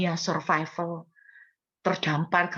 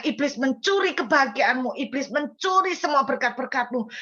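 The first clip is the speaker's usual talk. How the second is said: fervent retold sermon; pastor's words preached; 5 semitones higher than usual. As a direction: proclaim it like a pulpit sermon. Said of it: "Itu bahasanya pendeta itu".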